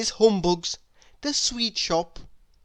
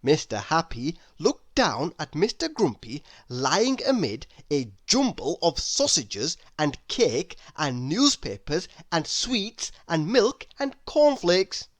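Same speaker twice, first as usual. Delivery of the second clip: ordinary delivery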